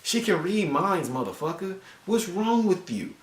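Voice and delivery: high, drawn-out incredulous scolding; exasperated disbelief. Acted. Reviewer scolding a character for fooling a mind reader, exasperated. Scolding Cyclops for lying to a telepath.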